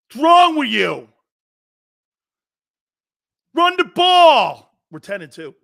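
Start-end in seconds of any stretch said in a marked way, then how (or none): 0.1-1.1 fan yelling. Acted. Acting angry Eagles fan yelling. 3.5-4.7 high, slow, loud mock fan shout; fan yelling. Angry Eagles fan yells at coaches, high, slow and loud.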